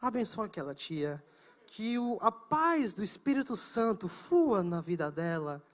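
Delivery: whisper-pray for peace over her; quiet prayer. Retold or imitated. Student quietly praying for the panicking woman, soft murmur. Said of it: "Só fiquei orando".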